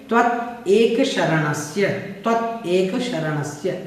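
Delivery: त्वत एक शरणस्य slowly, each word apart; word split. Shown as a demonstration. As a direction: separate त्वत and एक clearly. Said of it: "अलग-अलग करेंगे".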